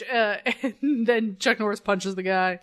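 normal talking voice

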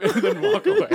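high laughing through words. Real, not acted. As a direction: burst out laughing mid-line. Laughing hard.

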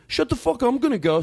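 high-pitched exasperated outburst; mock protest. Host voicing friends who can't object, mock exasperation. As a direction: imitate a friend who is fed up.

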